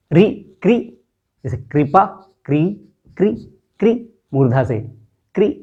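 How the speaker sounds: क्री said crisply, tongue curled back; retroflex ri demo. Shown as a demonstration. Teacher repeats for pupils, crisp क्री. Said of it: "मूर्धा से री आ रही है".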